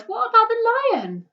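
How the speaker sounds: high, loud anguished cry; a boy crying out in alarm; desperate boy's outcry. A narrator's reading. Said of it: "he cried".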